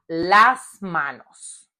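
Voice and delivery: "las" drawn out before "manos"; teacher's stretched "las" in "las manos"; stress on LAS. Shown as a demonstration. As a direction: slowly lean on "las", then drop onto "manos". Teacher stresses the plural article.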